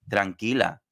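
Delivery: normal talking voice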